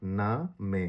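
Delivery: না মে slowly split as "naa... may"; German pronunciation. Shown as a demonstration. Teacher repeating the German word for learners, German pronunciation. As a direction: repeat না মে syllable by syllable, final e sounded. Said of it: "German ভাষা হবে".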